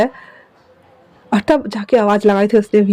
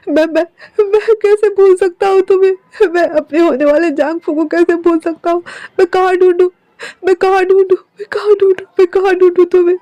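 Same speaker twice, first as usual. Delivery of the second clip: higher, sobbing, despairing pleas; desperate crying self-blame; weeping apology; 6 semitones higher than usual. Acted. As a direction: break down begging forgiveness. Wing weeps at the stone.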